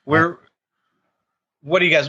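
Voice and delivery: usual speaking voice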